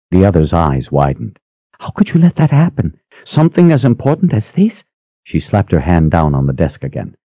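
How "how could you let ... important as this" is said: a much higher pitched, alarmed voice; indignant junior sister; high, upset younger sister. A narrator's reading. Shocked sister scolds.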